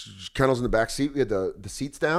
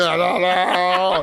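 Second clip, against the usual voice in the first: whiny dog; 4 semitones higher, 4 dB louder, much slower than usual. Retold or imitated. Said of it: "She's like".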